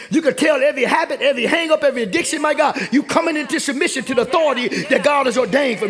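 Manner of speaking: high, driving sermon delivery; impassioned church preacher in full flow; fervent preaching. Real, not acted. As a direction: preach it hard, punching the key phrases.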